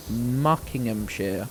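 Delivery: slow, drawn-out Muckinghamshire. Shown as a demonstration. Streamer spelling out a pun for chat, said slowly. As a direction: stretch out Muckinghamshire syllable by syllable. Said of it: "let me say that slower".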